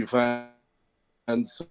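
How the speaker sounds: usual speaking voice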